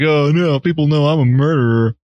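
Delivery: embarrassed, hammed-up "oh no"; mock cringing. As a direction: play a cringing man who's been found out. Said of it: "He's cringing".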